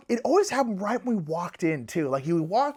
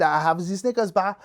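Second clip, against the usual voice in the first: mock French-accented announcement; put-on French accent. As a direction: imitate a French woman's English. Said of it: "this is my French accent".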